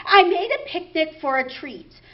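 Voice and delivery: ordinary talk, nothing marked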